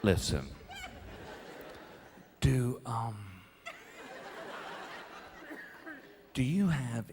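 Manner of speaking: low, soft, hesitant mumble; mortified near-whisper with nervous laughs; embarrassed hushed asking. Retold or imitated. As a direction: ask like you hope nobody hears. Embarrassed dad asking clerk quietly.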